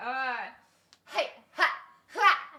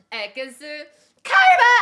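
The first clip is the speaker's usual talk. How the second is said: huge, much higher shout cracking at the top; yelled attack name; 7 semitones higher, 14 dB louder than usual. Shown as a demonstration. Streamer yelling the attack name for a voice line, cracking shout. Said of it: "Voice crack".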